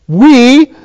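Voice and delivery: WE held out, high and emphatic. Shown as a demonstration. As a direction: lean hard on "we". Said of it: "very emphatically".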